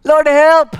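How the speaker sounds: high, loud cry for help; desperate shout for help. Retold or imitated. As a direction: yell up to God. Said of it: "He was shouting and shouting".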